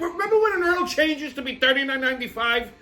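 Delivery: high-pitched, incredulous outburst; exasperated rant. Retold or imitated. Customer fuming over what an oil change costs, exasperated.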